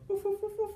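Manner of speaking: high little woofs; yappy puppy barks. Pretend. Storyteller barking as the puppy Pup. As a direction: bark like a small pup.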